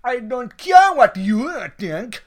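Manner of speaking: loud, slow, high-pitched retort; angry retort. Acted. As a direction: push back hard in a quarrel. Waiter argues with the cook.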